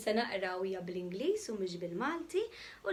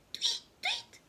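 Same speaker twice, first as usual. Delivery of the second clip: very high tweeting; bird tweet. Acted. The hidden bunny pretends to be a bird. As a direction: chirp like a little bird.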